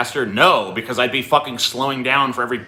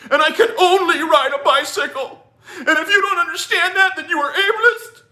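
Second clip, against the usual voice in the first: mock whiny arguer; 13 semitones higher than usual. Host mocks bicycle defenders' fake argument, whiny mimicry. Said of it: "And they're like".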